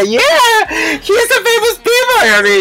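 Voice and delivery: high, slow, hammed-up squeal; imitating excited friend. Friend boasting to the Japanese man, imitated high squeal. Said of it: "la Cata le decía".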